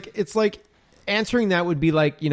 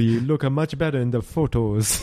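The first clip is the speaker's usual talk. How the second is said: bad Eastern European accent. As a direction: badly imitate an Eastern European photographer coaxing. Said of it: "we both suck at accents".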